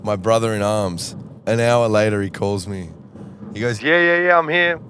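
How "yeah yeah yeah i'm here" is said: much higher, chirpy phone voice; cheerful bloke on the phone; Michael's voice. Retold or imitated. Impersonating Michael.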